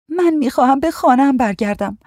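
put-on high, plaintive young girl's voice; pleading girl. A narrator's reading. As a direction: plead to be let go, pitched well up. Captive girl pleads to leave.

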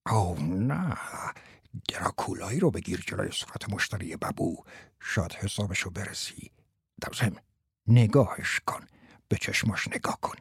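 low, hushed coaching aside; whispering. A narrator's reading. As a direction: whisper instructions to the pupil on the quiet. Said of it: "باز با پچ پچ گفت".

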